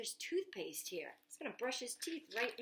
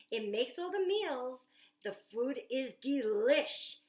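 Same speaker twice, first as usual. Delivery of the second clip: put-on louder, showing off the kitchen to the kids; eager storytime reading; 2 semitones higher, 5 dB louder than usual. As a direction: sell the gadget like an excited boy. Reads Jack's lines aloud.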